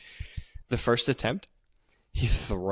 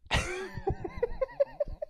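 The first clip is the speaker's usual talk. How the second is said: high squealing laugh; 4 dB quieter than usual. Real, not acted. Laughing at absurd scene.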